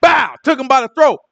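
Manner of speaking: ordinary delivery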